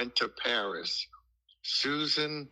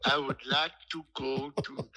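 mock French accent. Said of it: "speaking with a French accent".

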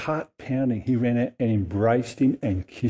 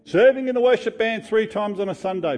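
resentful rant; 6 semitones higher than usual. Acted. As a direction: pour out the grievance, pitch rising high. Elder son's resentful complaint.

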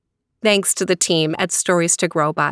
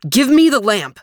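louder, hasty demand; snatch at it, rushed and pushy; hurried call; 4 semitones higher, 4 dB louder than usual. A narrator's reading. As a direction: magician rushes the boy for the lamp, hasty call. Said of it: "called out in a great hurry".

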